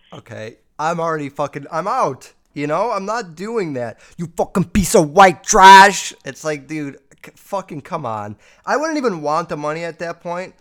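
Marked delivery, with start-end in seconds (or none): 4.2-6.1 very loud, slow, high imitation of a raging caller; mock furious yell. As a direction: bellow it, mimicking the angry voicemail. Mimicking the angry voicemail caller.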